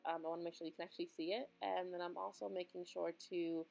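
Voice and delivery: soft, even tutorial voiceover; voiceover mode. Acted. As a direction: narrate the steps calmly to camera. Voiceover narration of makeup.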